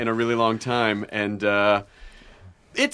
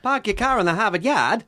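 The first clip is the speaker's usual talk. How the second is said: put-on Australian accent; 6 semitones higher than usual.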